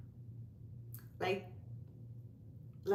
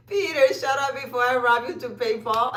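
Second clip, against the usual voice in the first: laughing reread; 3 semitones higher, 6 dB louder than usual. Real, not acted. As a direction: read it again through giggles. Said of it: "rereads comment while laughing".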